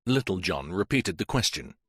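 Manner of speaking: ordinary delivery, nothing marked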